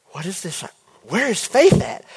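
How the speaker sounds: rising, baffled question; puzzled then alarmed, voice jumping up; confused alarm. Retold or imitated. Retelling the dream, asking where Faith is, rising alarm. Waking to strange phone contacts.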